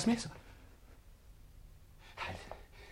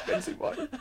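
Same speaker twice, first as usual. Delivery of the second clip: laughing. Acted. Laughing offer.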